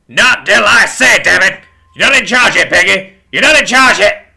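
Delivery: loud, high enraged bellow; explosive, building rage; furious shout. A narrator's reading. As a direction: scream down your insubordinate partner. Horace erupts at Pig.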